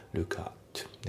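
"ルックアット" joined as "lukat"; linked pronunciation. Shown as a demonstration. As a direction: pull the K onto the A in "ルックアット". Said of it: "リンキングで".

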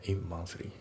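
"In monthly" as "it monthly" without the T; dropped T. Shown as a demonstration. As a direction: let the T of it vanish into the m. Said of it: "ItのTが抜けて". (Tが抜けた「In monthly」)

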